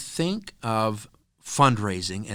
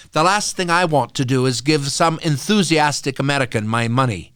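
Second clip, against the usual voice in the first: louder, higher British woman impression; mock English accent; 4 semitones higher, 9 dB louder than usual. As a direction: put on an indignant English accent, loudly. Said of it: "pardon my English accent".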